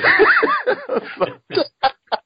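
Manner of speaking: long, high real laughing fit; helpless laughter. Real, not acted. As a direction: keep cracking up, unable to stop. Still cracking up mid-intro.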